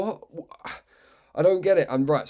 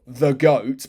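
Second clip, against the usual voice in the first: the goat said louder with real admiration; fond emphasis; 6 dB louder than usual. Real, not acted. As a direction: lean into the goat proudly. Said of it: "you can hear in my voice that I think he's the goat".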